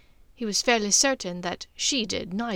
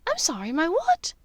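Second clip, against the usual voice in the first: baffled; 8 semitones higher, 4 dB louder than usual. Acted. Draco baffled by insult.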